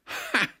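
sharp, very high scornful "Ha!"; a mayor scoffing at the telegram. Acted. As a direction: bark a mocking laugh of disbelief. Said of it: "rief der Bürgermeister".

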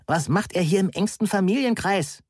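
high, mildly outraged voice; indignant. A narrator's reading. Heike protesting a stranger at the family gathering, indignant. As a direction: object indignantly to the intruder. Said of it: "der immer noch empörten Frau".

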